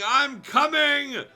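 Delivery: slow, shrill charging scream; screaming battle cry. A narrator's reading. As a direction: shriek it as she charges down. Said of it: "giving out a loud shriek and screaming".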